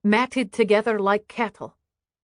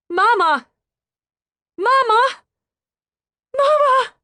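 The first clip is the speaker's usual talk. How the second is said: high, loud screams rising each time; a child screaming to wake her mother, climbing higher; 8 dB louder than usual. A narrator's reading. Daughter screaming in her fallen mother's face, frantic. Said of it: "She is screaming in my face".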